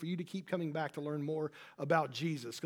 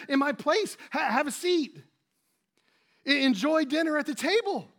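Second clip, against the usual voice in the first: much higher and louder, giddy with a laugh; gushing excitement; 9 semitones higher, 7 dB louder than usual. Retold or imitated. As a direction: greet a servant like a long-lost star. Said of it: "you say".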